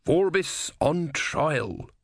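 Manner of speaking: thinking aloud, very slowly; musing. A narrator's reading. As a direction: savour the idea, word by word. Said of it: "Simony mused".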